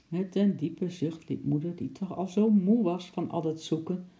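usual speaking voice

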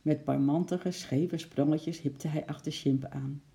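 ordinary delivery

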